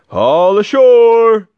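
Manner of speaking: loud, high, drawn-out call; ringing sailor's holler, high and stretched; shout. A narrator's reading. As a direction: call it out long across the deck. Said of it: "the men shouted".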